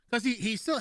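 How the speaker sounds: ordinary delivery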